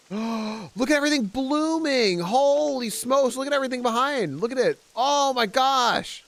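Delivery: awed delight. Real, not acted. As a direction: gasp and marvel at it all. Garden blooms after rain.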